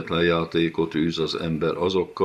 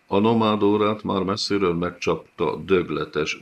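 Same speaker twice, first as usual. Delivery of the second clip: ordinary delivery, nothing marked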